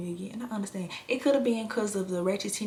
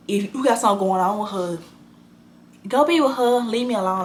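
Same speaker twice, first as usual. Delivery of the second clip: retold yell at boyfriend; 2 semitones higher, 8 dB louder than usual.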